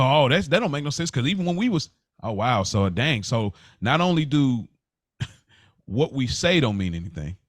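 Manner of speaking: ordinary talk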